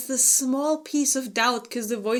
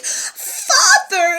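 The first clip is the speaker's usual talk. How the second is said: high, louder hammed-up call; dramatic mock cry; 13 semitones higher, 8 dB louder than usual. Retelling Bjorn crying out to Harald, hammed-up. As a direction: fling the word out like a soap opera. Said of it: "turns around and is like".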